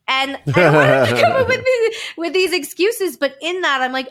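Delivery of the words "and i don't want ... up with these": words bubbling with laughter; amused laugh at her son's hangry excuse. Real, not acted. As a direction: laugh as you say it.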